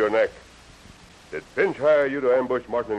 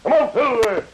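loud high call to the horse; 11 semitones higher, 5 dB louder than usual. Acted. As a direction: sing it out as you ride off. Rider calling horse as he leaves.

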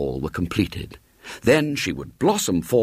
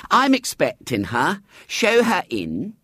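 Mrs Bott voiced; 9 semitones higher than usual. A narrator's reading. Mrs Bott answers the manageress.

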